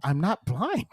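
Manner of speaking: indignant protest, laughing through it; mock outrage while laughing. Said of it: "I'm like".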